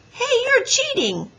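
loud, very high protest; outraged boy's objection; objecting. A narrator's reading. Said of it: "objected Henry".

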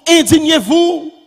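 fervent preaching. Real, not acted. Preacher urging the faithful against vice, fervent preaching. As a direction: preach it as a ringing call to indignation.

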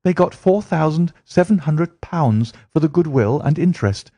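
high, eager voice of a gossiping typist; Mary telling Holmes about her mother's remarriage, high and eager; chatty young woman. Acted. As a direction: rattle through the family history. Mary tells family story.

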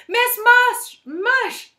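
very high, loud scream for attention; full-volume shriek; shouting. A narrator's reading. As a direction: yell out desperately for help. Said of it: "shouted Allison".

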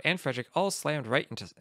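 ordinary delivery, nothing marked

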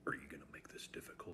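fast, hushed threatening whisper; menacing whisper. Acted. Goofy threatening Damien over money, hushed whisper. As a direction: breathe the threat out.

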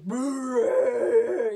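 long, loud mock bellow; hammed-up beast noise; imitated gorilla roar.

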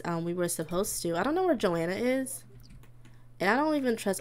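ordinary talk, nothing marked

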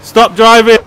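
loud, rising, high shouts; frantic yelling. Real, not acted. Player dragged off by a car, loud frantic shouts. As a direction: bellow at the driver to stop. Yelling while being driven off.

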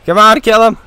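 loud urgent cheering; shouting. Real, not acted. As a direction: yell come on, kill him. Urging teammate during game.

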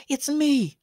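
high, sweet cartoon girl voice; gentle teasing from a magical pony girl; bright high pony girl reassuring him. A narrator's reading.

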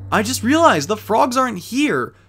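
high, loud burst of realization; excited discovery; sudden excitement. Real, not acted. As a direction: blurt it out, suddenly thrilled. Sudden realization mid-playthrough.

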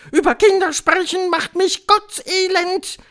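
very high, loud witch voice; calling Grand High Witch. A narrator's reading. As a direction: cry out in shrill revulsion. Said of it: "rief die Hoch- und Großmeisterhexe". (rufende Hexe, schrill und laut)

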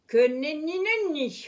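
sing-song no way; pirate taunting Peter Pan; mocking refusal. A narrator's reading. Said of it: "répondit le pirate".

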